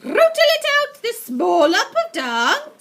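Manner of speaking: very high, loud, slow screech. Acted. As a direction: screech with fury. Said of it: "screeched the Grand High Witch".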